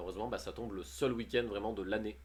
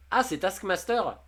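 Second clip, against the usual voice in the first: loud, high "ah" of sudden recognition; amused surprise at the translation; sudden realization; 8 semitones higher, 7 dB louder, slower than usual. Real, not acted. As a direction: it clicks, blurt it out. Realizing the French name.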